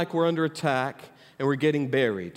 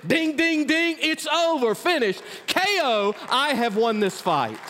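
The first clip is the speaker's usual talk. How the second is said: much higher, ringing victory cry; triumphant ring call; 10 semitones higher than usual. Retold or imitated. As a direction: announce the knockout like a ringside win. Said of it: "and he said".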